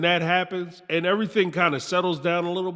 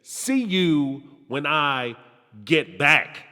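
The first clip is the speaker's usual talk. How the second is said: "See you when I get back" drawn out slowly, with the final "back" rising; slow pointed repeat of "See you when I get back"; slow stressed quote; 4 semitones lower, much slower than usual. Retold or imitated. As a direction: spell out each word, slowly and pointedly. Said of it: "The part that's left off is where she says".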